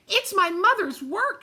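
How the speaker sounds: high, loud desperate cry; screamed. Acted. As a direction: scream it at the storm. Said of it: "she screamed".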